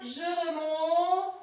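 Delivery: slow singsong, vowels stretched; drawn-out climb. Pretend. Teacher riding back up the letter, slow stretched chant. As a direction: stretch "remonte" like a slow ride up.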